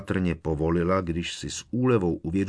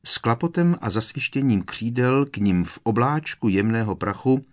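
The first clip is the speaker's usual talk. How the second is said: plain reading voice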